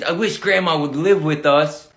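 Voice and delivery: usual speaking voice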